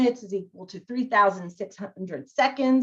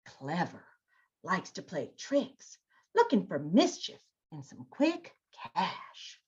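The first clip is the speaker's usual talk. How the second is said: much softer, sneaky fox voice; sly fox; 4 semitones lower, 9 dB quieter than usual. Shown as a demonstration. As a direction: hush it like a scheming fox. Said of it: "do all the characters that way".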